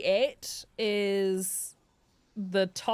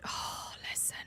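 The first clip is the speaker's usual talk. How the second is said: whisper; 12 dB quieter, slower than usual. Real, not acted. Hushed aside to audience.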